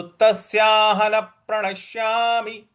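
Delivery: intoned तस्याहन प्रणश्यामि, doubled p starting प्रणश्यामि; stress on प. Shown as a demonstration. Teacher modelling the join, stressed प in प्रणश्यामि. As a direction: push into प of प्रणश्यामि on a level tone. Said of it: "इस प्रकार से इसको बोलेंगे".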